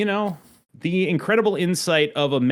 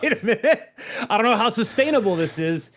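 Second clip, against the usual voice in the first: laughing mock alarm, pitch jumping higher; 9 semitones higher than usual. Retelling a podcast guest going off-topic, laughing. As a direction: play the panic but crack up while saying it. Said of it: "And it's like".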